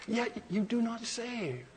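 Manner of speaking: very soft, slow reproach; hushed lament. Acted. Prophet concludes his verse to God, hushed lament. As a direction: drop to a hush and draw it out.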